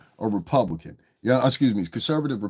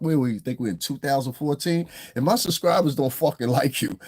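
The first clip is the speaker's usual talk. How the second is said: ordinary talk, nothing marked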